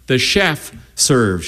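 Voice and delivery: slow, hushed motherly reminder; a touch of a mother setting a rule; mother's gentle correction. Acted. Mother reminding her son the chef serves, slow and hushed.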